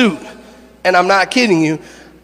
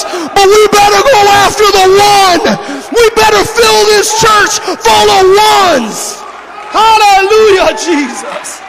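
full-force preaching; 8 semitones higher than usual. Acted. Pastor shouting to his congregation about the lost one. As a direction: preach it to the rafters, building fire.

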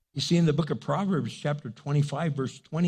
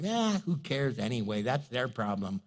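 mock careless voice, much softer and dropped low; apathetic shrug; 5 semitones lower, 5 dB quieter than usual. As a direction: toss it off like someone who couldn't care less. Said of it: "Apathy is where you just don't care anymore".

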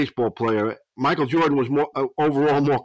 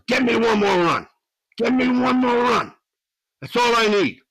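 El Duque's Spanglish voice; 8 semitones higher than usual. Retold or imitated. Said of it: "and he goes".

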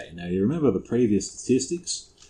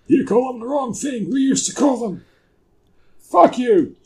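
high, loud put-on voice; hammed-up high-pitched ranting; mock outrage; 8 dB louder than usual. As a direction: squawk it in comic indignation. Mock outrage over the word.

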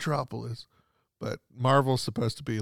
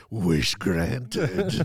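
evil spirit. Retold or imitated. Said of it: "acting like the evil spirit going to grant the wish".

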